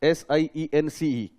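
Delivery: ordinary delivery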